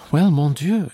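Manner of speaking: high, loud exclamation; exclaiming. A narrator's reading. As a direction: burst out in mild protest. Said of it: "she exclaimed".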